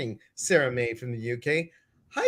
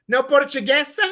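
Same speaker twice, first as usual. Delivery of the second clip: high, loud question in mock Spanish; hammed-up Spanish-sounding Portuguese; mock Spanish accent; 11 semitones higher, 6 dB louder than usual. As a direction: make it sound Spanish, pitched up and punchy. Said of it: "I wanna make everything Spanish sounding".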